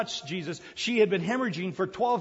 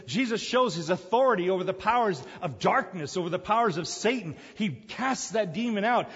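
ordinary talk, nothing marked

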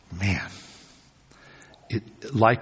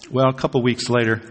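ordinary talk

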